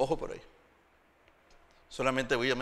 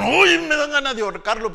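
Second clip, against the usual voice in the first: mock fury; 10 semitones higher, 11 dB louder than usual. Quoted angry expression.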